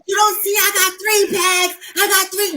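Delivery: high-pitched, exasperated mock yell; acting out an indignant yell; retold yelling back. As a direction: holler back in protest, pitched well up. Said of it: "I'm yelling at her".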